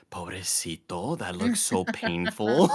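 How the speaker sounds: slow, drawn-out motherly sympathy, lightly imitated; pitying mom. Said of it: "She goes".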